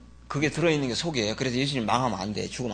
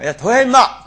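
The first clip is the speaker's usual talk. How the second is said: loud, high mock command; sudden acted yell; barked order; 9 semitones higher, 13 dB louder than usual. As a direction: shout it like an impatient boss. Acts out being ordered to continue.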